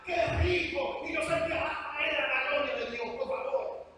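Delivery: hammed-up emotional sermon, pitched high; mock fiery preaching. Shown as a demonstration. Preacher showing the congregation how sermons manipulate feeling, hammed-up fiery preaching. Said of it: "lo voy a hacer aquí frente".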